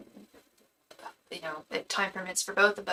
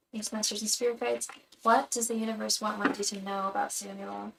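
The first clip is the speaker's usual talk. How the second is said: a soft, repeated invocation of the spirit guides; murmured invocation; 5 dB quieter than usual. Acted. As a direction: chant it quietly like a ritual prayer.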